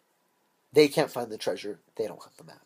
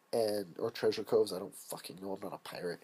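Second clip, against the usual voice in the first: normal talking voice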